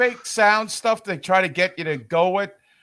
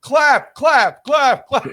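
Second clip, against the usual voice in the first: mock yell; 7 semitones higher than usual. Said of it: "Mando would actually yell out".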